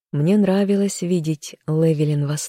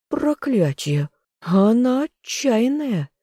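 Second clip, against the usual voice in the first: slow, much higher amazed exclamation; 8 semitones higher, slower than usual. A narrator's reading. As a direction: marvel at her nerve. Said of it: "присвистнул Тессен".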